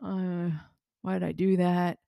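regretful groan. Retold or imitated. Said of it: "You're like".

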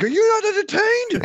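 very high, shouted taunt to the crowd; gladiator arena shout. Retold or imitated. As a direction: rile up the Colosseum crowd. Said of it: "I was like".

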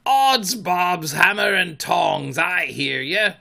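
Hook. A narrator's reading. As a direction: answer as a blustering pirate. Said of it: "answered in Hook's voice".

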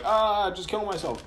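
retold panicked thought, pitched high; mock panic. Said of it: "a place of just pure panic".

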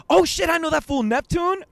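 loud, high mock exclamation; excited recognition. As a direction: imitate a stranger thrilled to recognize someone. Retells extra's surprised recognition.

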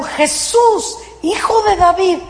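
a high mock cry for mercy; pleading cry. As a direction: call out to Jesus over the crowd. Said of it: "Y le gritaron".

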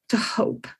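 fighting back tears on the last words; choked up. Real, not acted. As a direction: voice breaking into tears. Speaker moved by own message.

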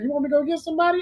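quick quote. Retold or imitated. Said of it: "he said".

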